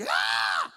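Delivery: loud scream of fright; mock terrified scream. Preacher re-enacts seeing a little mouse, loud scream.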